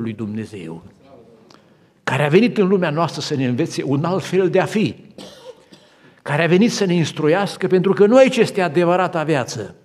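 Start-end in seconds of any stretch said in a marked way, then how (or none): 2.0-9.7 louder, higher preaching; preaching at full force. Acted. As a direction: proclaim it to the whole church. Sermon climax.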